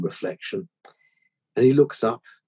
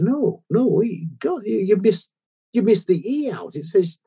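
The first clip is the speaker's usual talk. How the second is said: much higher, outraged customer voice; indignant complaint; 12 semitones higher than usual. Retold or imitated. Angry customer complains about headstone.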